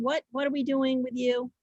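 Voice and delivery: ordinary talk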